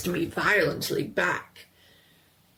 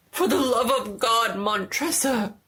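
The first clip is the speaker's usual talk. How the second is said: higher, louder crying plea; desperate plea; 5 semitones higher, 5 dB louder than usual. A narrator's reading. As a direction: beg for your life. Trapped man begs.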